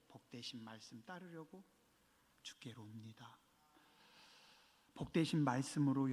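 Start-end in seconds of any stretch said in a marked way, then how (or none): 0.1-3.4 soft echo. Real, not acted. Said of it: "echo repeated softly".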